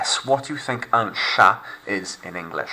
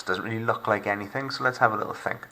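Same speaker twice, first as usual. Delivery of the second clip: normal talking voice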